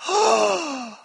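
put-on a little boy gasping at the sky; child's amazed oh. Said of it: "my son Jude just looked up and went".

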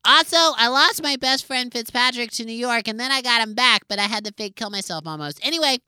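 self-adoring award speech; vain acceptance speech. Acted. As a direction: bask in the applause.

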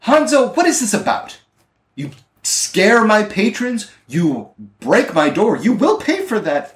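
high-pitched, indignant woman's voice; tavern owner. Acted. Tavern owner confronting the captain over her door, indignant. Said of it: "from the tavern owner, she says".

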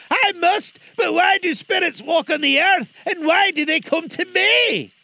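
put-on high, loud, pleading old man; frightened Scrooge.

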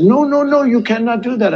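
high-pitched mock French protest; mock French accent. French leader refusing the tariff threat, mock French accent. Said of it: "he's doing his voice impressions".

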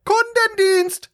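very high, slow, drawn-out shouts; yelling into phone. Retold or imitated. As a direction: scream it at the phone menu. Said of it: "sofort reingerufen".

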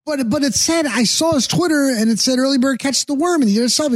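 high, rushed disbelief; insisting it can't be true; flustered protest. Retold or imitated. Arguing with the radio host that the post was fresh, high and flustered.

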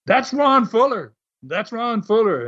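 high-pitched mock yell; retold scream. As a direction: imitate an angry protest, volume held back. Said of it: "they screamed".

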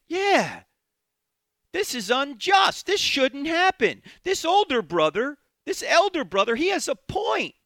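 mocking self-righteous complaint; indignant Pharisees. Voicing grumbling Pharisees.

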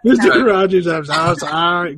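loud, hammed-up sobbing plea; mock blubbering apology. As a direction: play a grown man begging through fake tears.

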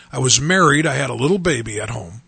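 ordinary delivery

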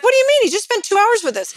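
high, loud mock protest; incredulous husband. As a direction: imitate a baffled husband, pitch shooting up. Retells husband's baffled protest.

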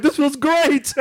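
high, giddy delight, acted out; gleeful relief. Husband among frozen peas and corn, acting gleeful. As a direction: revel in it like a naughty husband. His gleeful reply to wife.

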